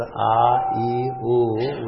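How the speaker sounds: A, E, U each held long and full; prolonged vowels. Shown as a demonstration. Teacher demonstrating prolonged sound, vowels drawn out slowly. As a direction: stretch out A, E and U. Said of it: "we have a prolonged sound".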